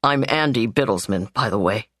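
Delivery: lightly voiced man excusing his sister; the brother. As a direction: explain gently as the steady brother. Narrator voices Andy.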